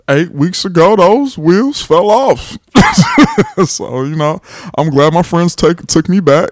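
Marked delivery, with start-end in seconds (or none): 2.6-3.6 high laugh. Real, not acted. Laughing at his breakup.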